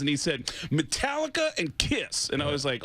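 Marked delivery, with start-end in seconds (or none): 0.7-2.3 high, drawn-out fan impression; slow, proud announcement in a high mimicked voice; retold excited fan. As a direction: imitate a fan savoring each name. Retold fan naming favorite bands.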